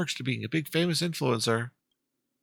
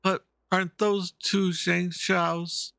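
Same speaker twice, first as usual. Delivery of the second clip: character voice; 6 semitones higher, much slower than usual. Acted. Character question read in voice.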